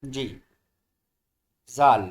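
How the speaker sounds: usual speaking voice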